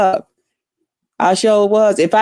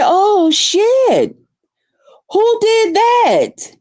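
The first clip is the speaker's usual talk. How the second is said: shocked outburst; 10 semitones higher, much slower than usual. Retold or imitated. Shocked reaction in the retold scene.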